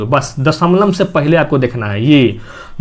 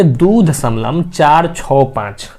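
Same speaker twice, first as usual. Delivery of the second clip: slow "दो दशमलव चार छः पाँच", each digit separate; correct digit-by-digit reading; much slower than usual. Shown as a demonstration. Teacher showing students the right way to read decimals, slow separate "चार छः पाँच". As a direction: spell out "चार छः पाँच" one digit at a time. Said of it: "इसको कैसे पढ़ेंगे?".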